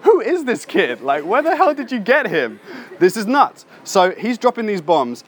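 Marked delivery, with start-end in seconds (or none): 0.0-3.6 high, stunned voices of the scholars; amazed scholars. Retold or imitated. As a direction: act out the teachers' disbelief. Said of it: "them going".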